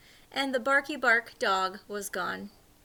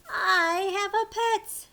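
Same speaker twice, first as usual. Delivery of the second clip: delighted; 7 semitones higher, 5 dB louder, slower than usual. Acted. Gilbert's excited line.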